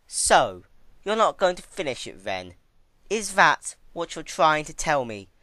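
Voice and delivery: a touch of sarcastic Twilight; mocking. Twilight taunting the weeping princess, sarcastic. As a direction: needle her with cold sarcasm.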